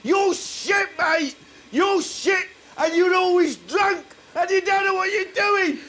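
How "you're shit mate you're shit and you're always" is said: enraged tormentor's outburst; furious outburst. Acted. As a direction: let it burst out high. Baboon erupts at host.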